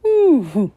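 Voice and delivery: loud wheezing walrus ooh; hammed-up sick creature moan; wheezy groan. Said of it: "wheezed Walrus".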